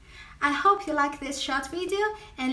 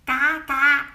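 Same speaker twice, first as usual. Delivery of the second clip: loud honking ga ga; duck quack; 8 dB louder than usual. Shown as a demonstration. Showing viewers the Chinese duck sound, loud quack. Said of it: "we say".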